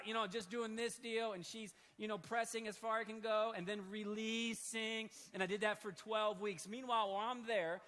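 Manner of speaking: ordinary talk, nothing marked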